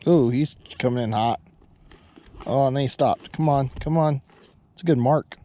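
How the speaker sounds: ordinary talk, nothing marked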